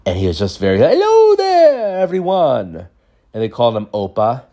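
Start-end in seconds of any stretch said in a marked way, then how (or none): 0.8-2.9 high, drawn-out, sing-out greeting; genie-like grandpa. Retold or imitated. Grandpa greets everyone like a genie, high, loud and slow. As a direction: imitate a genie booming out hello in a high voice. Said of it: "like, a fairy or, like, like the genie".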